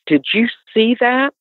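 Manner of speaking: high, amazed did you see that; stunned question. Retold or imitated. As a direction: ask it in disbelief. Husband asks, stunned.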